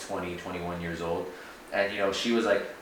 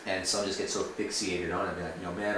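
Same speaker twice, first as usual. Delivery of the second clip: ordinary talk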